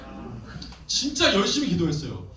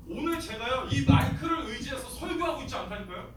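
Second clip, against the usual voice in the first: higher, softer preaching voice; unamplified preaching; 7 semitones higher, 7 dB quieter than usual. Acted. To the congregation with the mic off, lifted preaching. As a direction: preach to the room without a mic.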